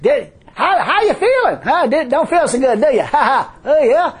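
high, loud jeering tempter with chuckles; hammed-up gloating heckler; mocking spirit. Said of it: "say on the way back".

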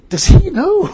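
high, loud question tipping into laughter; incredulous outburst. Retold or imitated. Preacher questioning whether God knows, loud laughing incredulity. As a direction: burst out in comic disbelief.

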